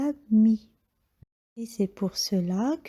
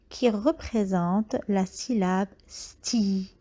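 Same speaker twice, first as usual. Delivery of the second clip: ordinary talk, nothing marked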